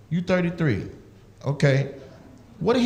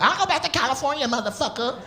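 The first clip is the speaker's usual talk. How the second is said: mock threat; 8 semitones higher, 6 dB quieter than usual. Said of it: "argument like".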